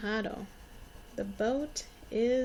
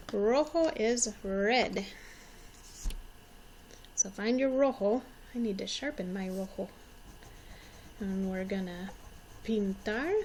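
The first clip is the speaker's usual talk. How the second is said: ordinary talk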